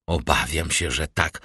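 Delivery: soft, low mock-reluctant admission; stage-comedy tone. A narrator's reading. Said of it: "odparł Andrea tonem, jaki słyszał w komedii". (cicho, tonem z komedii)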